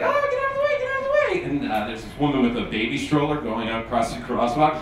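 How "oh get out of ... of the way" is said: much higher shrieking mock panic; Sandra Bullock swerving the bus, mock shriek; panicked Sandra Bullock. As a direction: squeal it like a frantic driver. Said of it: "she's like".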